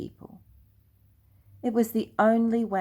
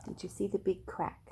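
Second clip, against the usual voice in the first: soft, quick whispered aside; hushed aside; 13 dB quieter, faster than usual. Real, not acted. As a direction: point at the picture in a hush. Aside to the listening child.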